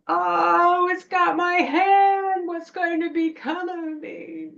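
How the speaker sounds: high, drawn-out mock wail; hammed-up despairing yell. Child fooling the witch with a branch, theatrical wailing. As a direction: melodramatic cry of doom, loud then easing off. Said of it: "I yelled".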